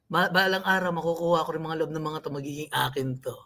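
low, soft inner monologue; secret scheming thought. Acted. Voices someone's secret scheming thought.

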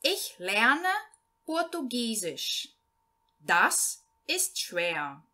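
slow, clear German read-aloud, each phrase set apart; slow learner reading. Shown as a demonstration. Teacher reading a German text to Portuguese-speaking beginners, slow and clear. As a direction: read it out slowly so beginners can follow.

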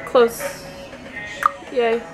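ordinary talk

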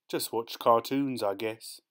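plain reading voice

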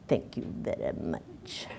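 dry breathy brush-off; dismissive. Retold or imitated. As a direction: wave it away, unvoiced. Dismissive quote of a self-made attitude.

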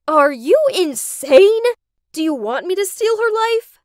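loud, high, incredulous challenge; outraged. A narrator's reading. Daughter confronts mom over her plan, outraged.

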